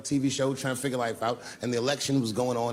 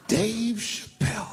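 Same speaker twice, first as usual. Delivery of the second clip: high, slow, drawn-out call of recognition; calling out his name; 9 semitones higher, much slower than usual. Retold or imitated. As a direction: call the name out across the room, stretched long. Obama spots him and calls out.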